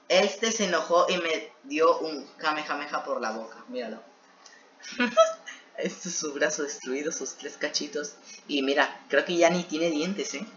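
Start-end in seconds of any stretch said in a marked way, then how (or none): 4.9-5.5 high giggle. Real, not acted. Laughing at story.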